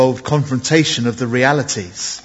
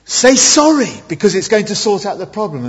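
insistent parent; 6 semitones higher than usual. Acted. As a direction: play a parent pressing a child to apologise. Parent challenging child to apologise.

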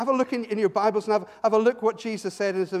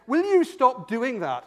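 higher, louder slow challenge; confrontational demand; 5 semitones higher, 5 dB louder, slower than usual. Retold or imitated. Gang member confronting the preacher, exasperated demand.

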